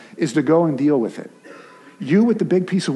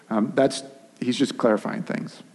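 normal talking voice

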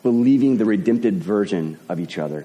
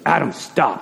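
grouchy uncle; 6 semitones higher than usual. Retold or imitated. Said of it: "my uncle can get a little grouchy".

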